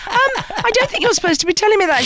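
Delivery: high, quick and alarmed; mock startled. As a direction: caught off guard, hurry to stop her. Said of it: "And I went".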